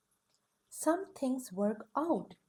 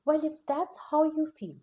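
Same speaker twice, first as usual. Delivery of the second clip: louder, huffy retort; indignant outburst; 5 semitones higher, 7 dB louder than usual. Acted. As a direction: push back, stung and proud. Chicken hurt, leaving.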